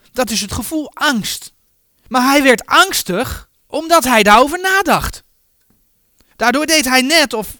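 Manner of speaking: normal talking voice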